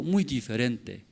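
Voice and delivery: ordinary delivery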